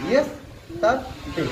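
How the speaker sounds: Yes, তার, ডে as three separate beats; teacher chopping a word into syllables. Shown as a demonstration. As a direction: pause after each part of the word. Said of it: "yes একটি syllable".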